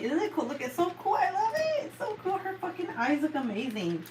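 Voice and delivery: high, delighted gush over her; thrilled squeal over the painting. Real, not acted. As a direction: squeal how cool it is. Gushing over finished section.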